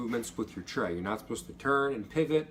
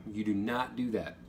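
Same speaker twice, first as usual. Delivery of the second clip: ordinary talk, nothing marked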